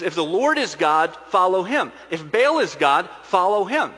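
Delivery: loud proclamation, lifting on each command; forceful ultimatum. Acted. Elijah gives the wavering people a choice, forceful. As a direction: lay down the choice to a crowd, loudly.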